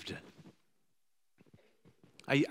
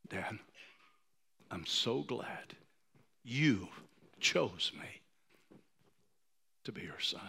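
tearful reading; 2 semitones lower, 6 dB quieter, slower than usual. Real, not acted. Rereading note, emotional.

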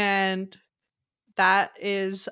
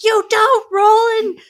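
high, loud, slow mock yell; loud exaggerated parent scream; 13 semitones higher, 11 dB louder than usual. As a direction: yell it like a furious mom. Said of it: "she's like".

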